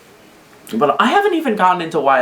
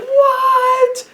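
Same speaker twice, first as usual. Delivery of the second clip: extremely high, louder stretched mock cry; hammed-up disbelief, pitched way up; shocked Susie; 4 dB louder than usual. Susie betrayed by Chris, high shocked cry. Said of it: "Susie's gonna be like".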